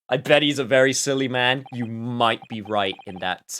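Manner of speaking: ordinary delivery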